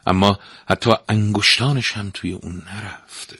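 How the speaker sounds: plain reading voice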